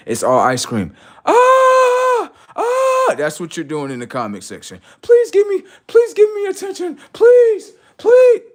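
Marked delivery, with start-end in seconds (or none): 1.2-3.1 very high, loud mock shriek; mock attention scream. Mocking the comment section, piercing fake scream. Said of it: "they be screaming for attention". 5.0-8.4 very high, loud begging screech; mock screaming plea. Mocking commenters begging for attention, shrill screamed plea. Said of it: "they be screaming for attention".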